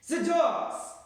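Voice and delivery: booming high shout, filling the room; witch's shout. A narrator's reading. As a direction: bellow it so it bounces off the walls. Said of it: "shouted the Grand High Witch".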